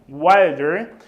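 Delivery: "wilder" punched loud on WIL; emphatic model word. Shown as a demonstration. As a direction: say "wilder" loudly for the class. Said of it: "teacher models comparative".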